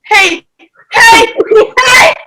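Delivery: high laughing screams of hey; laughing shrieks. Real, not acted. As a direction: shriek and laugh as she's crowded. Friend gets in her face.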